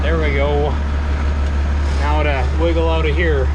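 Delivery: strained, squeezed voice, loud; pushed out while wriggling free; straining. Real, not acted. Squeezing out from under planter.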